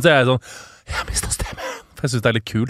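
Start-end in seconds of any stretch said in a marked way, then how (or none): 0.9-1.7 voiceless, softer exclamation; lost voice. Retold or imitated. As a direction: exclaim it with no voice left. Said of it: "Hver gang jeg mister stemmen". (stemmeløst, hest utrop)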